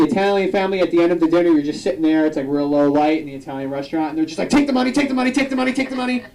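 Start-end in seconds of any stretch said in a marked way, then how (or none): 4.5-6.2 high, insistent repeated offer; mock reverse holdup plea; pushy relative. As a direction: shove the money at them. Italian relatives pushing money.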